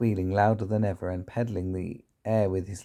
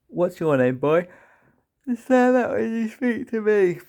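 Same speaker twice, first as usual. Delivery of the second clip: put-on high, loud, bullying roar at the boy; fierce headmistress; 12 semitones higher, 7 dB louder than usual. Trunchbull roaring at the small boy to sir her. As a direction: bellow down at the cowering boy.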